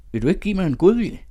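put-on high, loud and fast girlish protest; a coy girl teasing a suitor, high and loud. A narrator's reading. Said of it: "Mette svalrede op".

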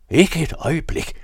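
loud, high exclamation of horror; outraged shout. A narrator's reading. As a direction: cry out in sudden refusal. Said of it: "råbte Cornelius".

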